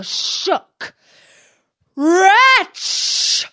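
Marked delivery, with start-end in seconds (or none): 1.9-3.5 high, loud enraged outburst; furious genie cry. Acted. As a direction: cry it out in a fury, much higher and louder. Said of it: "he cried".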